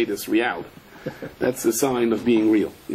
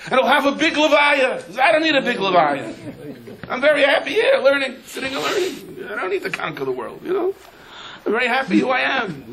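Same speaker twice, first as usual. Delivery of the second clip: rav quoted higher with a laugh; 10 semitones higher than usual. Retold or imitated. The rav laughs off a big funeral, higher and amused. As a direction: chuckle through his refusal of honor.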